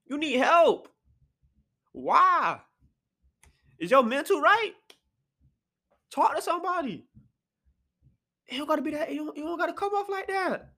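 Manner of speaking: high-pitched, exasperated appeal; high, disbelieving advice; exasperated pleading. Real, not acted. Pleading with the absent rapper.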